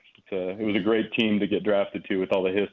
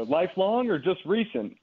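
put-on much higher, drunken challenge; drunk fan; 9 semitones higher than usual. Drunk fan grills him about being a real fan, higher slurred challenge. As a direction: test his loyalty like a tipsy fan. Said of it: "and he goes".

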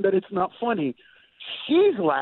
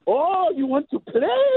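teasing mom; 7 semitones higher than usual. Retold or imitated. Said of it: "my mom is hopping up and down going".

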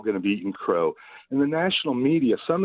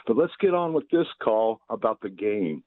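ordinary delivery

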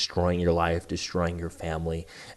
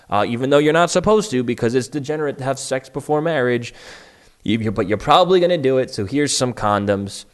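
mock sex-ed teacher; 6 semitones higher, 6 dB louder than usual. Mock sex-ed talk at school.

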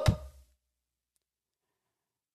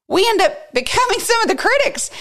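emphatic; faster than usual. Real, not acted. Peak of preaching emphasis.

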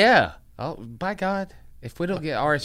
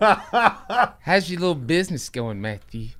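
mock-patronising small talk, louder and drawn out; demeaning; 3 semitones higher, 4 dB louder, slower than usual. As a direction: belittle him with a fake-interested question. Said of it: "So demeaning".

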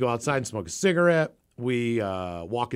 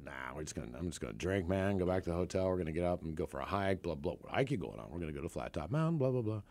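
casual brush-off; 6 semitones lower, 10 dB quieter, faster than usual. Retold or imitated. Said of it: "I said".

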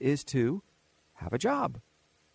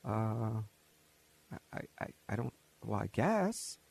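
hesitant mumble; 6 semitones lower, 8 dB quieter than usual. Retold or imitated. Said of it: "And you guys go".

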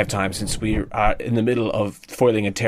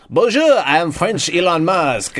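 slow, higher mock French accent; hammed-up French accent, drawn out; 6 semitones higher, 4 dB louder than usual. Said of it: "Tom Cruise leans out and he's like".

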